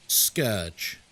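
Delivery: "Scourge" as SKURJ, low and deliberate; low, instructive "Scourge" with an UR vowel; correct pronunciation. Shown as a demonstration. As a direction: say "Scourge" to rhyme with urge. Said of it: "Like, no, it's scourge".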